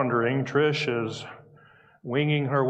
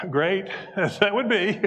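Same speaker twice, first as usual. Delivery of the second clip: laughing; 7 semitones higher than usual. Real, not acted. Speaker still tickled by the billion-dollar answer, laughing while talking. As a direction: talk through real amusement.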